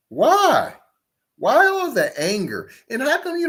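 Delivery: high-pitched, incredulous why; incredulous demand. Real, not acted. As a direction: throw the question up high in disbelief. Challenging the clip speaker's anger.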